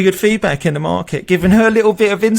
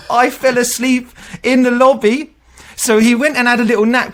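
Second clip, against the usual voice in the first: higher, louder, breaking into laughter; giggly confession; amused retelling; 7 semitones higher, 4 dB louder than usual. Retold or imitated. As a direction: barely hold the laugh in while quoting. Candidate confesses, teller amused.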